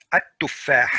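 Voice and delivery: At-tuffah with the l swallowed into a doubled t, at-TTUF-fah; Arabic sun letter assimilation: At-tuffah; correct at-tuffah. Shown as a demonstration. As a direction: say At-tuffah as att-tuffah with no l sound. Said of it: "You don't say al-tuffah".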